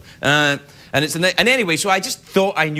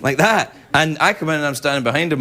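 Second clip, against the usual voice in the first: normal talking voice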